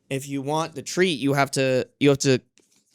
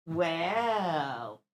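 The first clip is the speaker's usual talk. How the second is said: ordinary delivery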